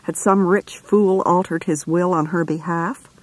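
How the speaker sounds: plain reading voice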